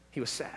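heavy sigh, then a whispered "He was sad"; sighing whisper of sorrow. Shown as a demonstration. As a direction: breathe it out like grief. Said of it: "the scripture means that he sighed".

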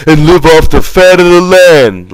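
loud high burst of joy; shouting. A narrator's reading. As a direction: blurt it out in excitement. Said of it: "Lenny shouted".

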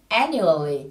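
"Annually" with AN strongest, falling away after it; first-syllable stress. Shown as a demonstration. Teacher showing students where the accent falls, "Annually" stressed on AN. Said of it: "Từ này trọng âm rơi vào âm tiết đầu tiên nhé".

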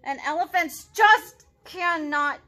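loud, slow, exasperated lament; frustrated Gerald. Acted. Gerald tells Piggy he will never dance, exasperated. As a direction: pour out the hopeless frustration.

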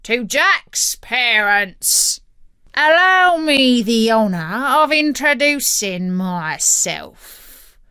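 slow, loud pirate grandeur; drawn-out, booming pirate dictation; pirate captain dictating. A narrator's reading. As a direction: introduce yourself pompously as a pirate captain.

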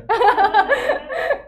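high loud laugh; loud burst of laughter. Real, not acted. Reacts to Nestle joke.

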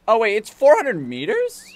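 loud, high incredulous question; startled disbelief. Real, not acted. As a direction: blurt it out, voice shooting up. Startled by the distance to go.

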